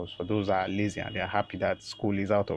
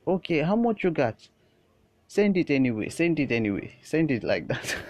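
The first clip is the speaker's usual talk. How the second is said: put-on loud, higher Indian-accented haggling; Patip's Indian accent; 7 semitones higher, 7 dB louder than usual. Patip bargaining with the secretary, loud easygoing Indian accent. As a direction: settle for anything, Indian accent, easing down. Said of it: "we just hear Patip like".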